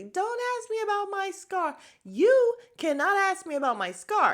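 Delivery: mock outraged outburst, pitched high and loud; going crazy. Castmate confronts a woman about her scar, mock furious. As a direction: snap high and loud, imitating her fury. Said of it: "Kay just goes crazy".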